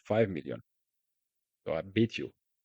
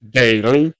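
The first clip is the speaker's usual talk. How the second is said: emphatic shout; 3 semitones higher, 13 dB louder than usual. Real, not acted. Emphatic loud punchline.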